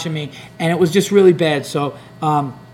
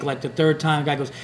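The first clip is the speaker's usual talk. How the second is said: usual speaking voice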